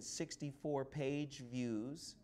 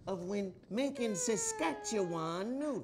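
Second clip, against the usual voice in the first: wrestling promo; 7 semitones higher, 6 dB louder than usual. Acted. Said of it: "it's a promo".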